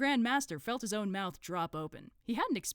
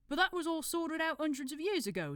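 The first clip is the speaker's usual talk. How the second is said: higher put-on accent for a dim cult member; Brother Watchtower; 11 semitones higher than usual. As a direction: interrupt helpfully in a put-on accent. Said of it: "decided to do an accent".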